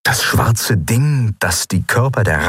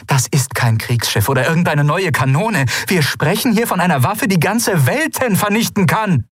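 rising, much higher anger; angry ruler; 10 semitones higher than usual. A narrator's reading. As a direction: she hammers home the danger. Lien rebukes her ministers. (zornig, immer höher)

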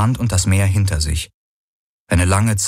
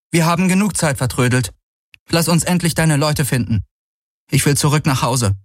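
higher-pitched voice of a hurt woman; higher, wounded and impatient; hurt heroine, impatient; 9 semitones higher than usual. Acted. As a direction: urge him on in a higher voice. Voices Kirai in the story.

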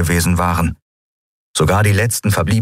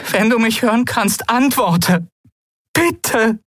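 pleading woman; slower than usual. Acted. Endril begs Keru to answer her, pleading. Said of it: "flehte sie".

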